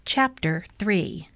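plain reading voice

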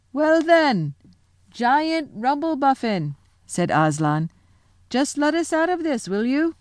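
high, slow hail to the giant above; lion calling up to giant. Acted. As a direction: call up kindly but firmly. Aslan asks giant.

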